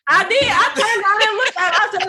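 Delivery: can barely get it out for laughing; laughing hard. Real, not acted. Laughing hard while telling story.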